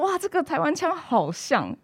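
slow, high amazed exclamation; a wowed listener praising the accent; impressed Shanghai audience. Retold or imitated. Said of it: "他们就会觉得说".

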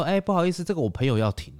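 high, polite imitation of the driver; mimicking the driver. Retells driver's polite request. (模仿司机客气地说话)

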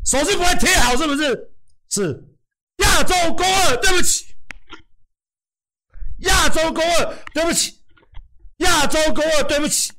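shouting a hammed-up sorry, very high; mock shouted apology. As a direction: bellow the fake apology like a drill.

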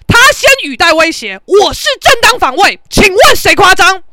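loud, high-pitched indignant rebuttal; defiant argument. Retold or imitated. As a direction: argue back at full volume, refusing to back down. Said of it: "要嘛要闹到底". (高声理直气壮地争辩)

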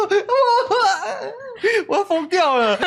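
high, laughing through the words. Real, not acted. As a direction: laugh while telling it. 笑着讲小美要疯. (边笑边说，声音很高)

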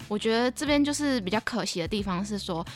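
usual speaking voice

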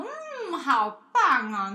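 slow, loud drawn-out praise; shower him with praise; praising. Retold or imitated. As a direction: godmother praising the child for finishing. Praising child.

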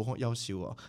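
ordinary delivery

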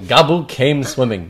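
plain reading voice

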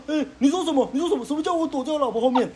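high indignant neighbor impression. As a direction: play the neighbor's huffy comeback. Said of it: "他说".

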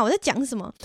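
ordinary talk, nothing marked

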